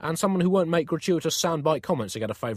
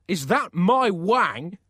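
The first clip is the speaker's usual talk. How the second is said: slow, raised, incredulous question; appalled disbelief; 6 semitones higher, 5 dB louder, much slower than usual. Acted. Pope spots himself in the painting, appalled disbelief. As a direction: stretch it out in mounting comic horror.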